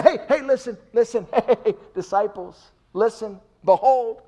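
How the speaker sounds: high, loud, eager call to gather the disciples; laughing shout for attention, hey hey listen; Jesus grabbing the disciples' attention, loud and high. Acted. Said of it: "He gets their attention".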